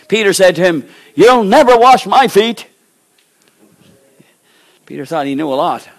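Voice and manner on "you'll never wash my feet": much higher, louder and slow, dig in; loud stubborn refusal. Acted. Peter flatly refuses to let Jesus wash him, loud and slow. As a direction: declare it like a stubborn disciple.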